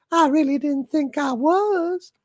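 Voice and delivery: demure falsetto Southern lady; genteel belle, very high. Shown as a demonstration. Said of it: "Southern Belle Doomcock is nothing".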